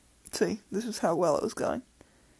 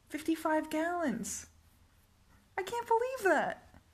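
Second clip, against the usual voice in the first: much higher, outraged exclamation; shocked disbelief; 9 semitones higher than usual. Real, not acted. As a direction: squeal it in disbelief. Outraged at the tank advice.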